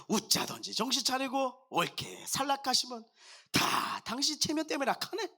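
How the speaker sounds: comic Gyeongsang dialect performance, swinging intonation. Shown as a demonstration. Non-native pastor trying Psalm 23 for congregation, playful Gyeongsang dialect. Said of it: "경상도 버전으로".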